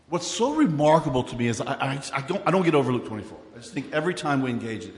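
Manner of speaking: ordinary talk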